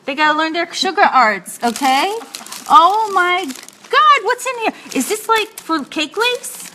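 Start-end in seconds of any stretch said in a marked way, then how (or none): 2.7-6.7 high, wondering exclamation; drawn-out amazement, pitch up; excited. Real, not acted. Host digging into the giveaway basket, high excited wonder. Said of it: "I'm very excited".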